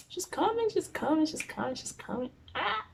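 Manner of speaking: high, fading panicked repetition; mock panic. Hearing mom on the stairs, panic fading to hush. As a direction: start high and panicky, then fade to a hush.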